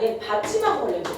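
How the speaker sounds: ordinary talk, nothing marked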